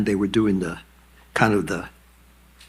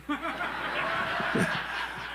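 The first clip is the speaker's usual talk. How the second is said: a high soundless wheeze of laughter; high breathy laugh; 7 dB quieter than usual. Real, not acted. Laughing at his own story of the Cuban greeting.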